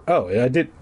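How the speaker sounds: usual speaking voice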